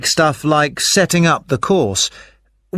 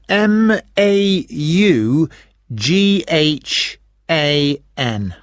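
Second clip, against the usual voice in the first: slow spelling; much slower than usual. Real, not acted. Spelling the surname for caller.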